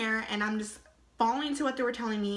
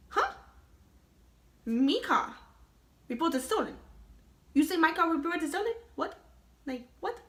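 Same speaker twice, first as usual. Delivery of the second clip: higher, put-on confused voice repeating the cop's words; baffled disbelief; 6 semitones higher than usual. Driver told her own car is stolen, mock-baffled and higher. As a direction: echo the accusation in mock disbelief.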